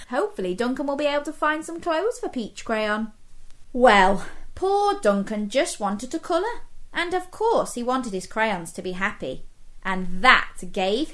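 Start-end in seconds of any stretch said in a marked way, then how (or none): none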